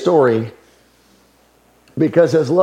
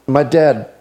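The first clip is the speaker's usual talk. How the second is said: ordinary delivery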